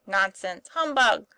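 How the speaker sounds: loud, emphatic dismissal rising on the second word; sturdy retort. A narrator's reading. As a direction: brush it off stoutly. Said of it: "said Tom sturdily".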